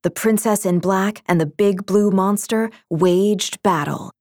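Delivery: plain reading voice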